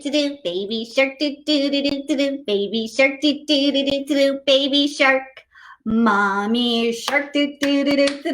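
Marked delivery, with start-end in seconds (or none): none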